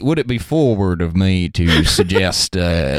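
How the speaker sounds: bashful English gent. Pretend. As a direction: play the shy stammering suitor. Continuing the bashful suitor.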